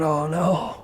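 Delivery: mock-fret about the oversight; a hint of panic at your own mistake; self-reproach. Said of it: "giving yourself a real hard time".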